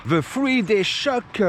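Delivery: slow, self-mocking English accent from a French speaker; English words in a lousy French-speaker's English accent. Shown as a demonstration. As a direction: drag it out in a bad English accent. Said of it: "avec mon accent anglais pourri".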